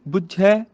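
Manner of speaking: ਬੁੱਝੈ as "bujjhai"; short u, open ending. Shown as a demonstration. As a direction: clip the u, open the end of ਬੁੱਝੈ. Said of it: "ਬੱਬੀ ਨੂੰ ਔਂਕੜ". (ਬੁੱਝੈ, ਔਂਕੜ ਤੇ ਦੁਲਾਵਾਂ ਨਾਲ)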